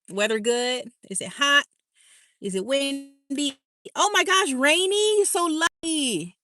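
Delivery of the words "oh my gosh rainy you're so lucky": high, delighted gush; oh my gosh, what a treat; high gushing envy of the rain; excited envy. Real, not acted. Envying rainy weather.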